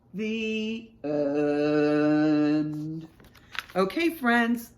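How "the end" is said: long, drawn-out closing words; story ending. A narrator's reading. Reader closes the book for children, drawn out. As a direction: stretch each word out to finish.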